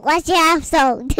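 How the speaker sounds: very loud yell into the mic; shouted question, overloading the mic. Real, not acted. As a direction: blast it out, far too loud for the microphone. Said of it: "be careful about yelling at it".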